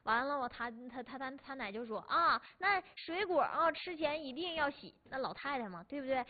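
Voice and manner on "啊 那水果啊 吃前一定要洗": slow, drawn-out nagging granny; a mildly voiced fussy old woman; grandma voice. Grandma nagging her grandson over the phone, slow. Grandma nags about washing fruit.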